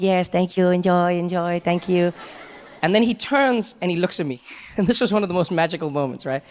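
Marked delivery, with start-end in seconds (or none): 0.0-2.1 polite host. Retold or imitated. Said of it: "he's like".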